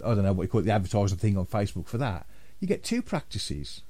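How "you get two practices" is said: market trader. Retold or imitated. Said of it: "I sound like a market trader".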